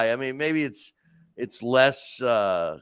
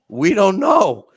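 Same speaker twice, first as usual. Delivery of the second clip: loud, raised-pitch punchline; imitated group admission; 5 semitones higher, 9 dB louder, slower than usual. Meeting attendees confessing ignorance of the acronym, loud blurt. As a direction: blurt it out loudly as a whole group.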